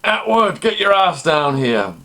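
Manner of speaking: high, loud guard's yell; yelled summons. Retold or imitated. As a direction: bellow an order to a prisoner. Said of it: "And he yells".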